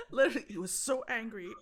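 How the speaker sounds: loud words through squealing laughter; laughing hard. Real, not acted. Owner describes the furious dog, laughing loudly. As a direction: still cracking up while talking.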